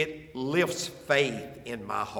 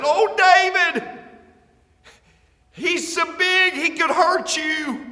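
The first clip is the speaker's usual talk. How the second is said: much higher and louder, a shouted warning; yelling a warning; 13 semitones higher, 9 dB louder than usual. Retold or imitated. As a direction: call out in alarm to David. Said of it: "somebody probably yelled out and said".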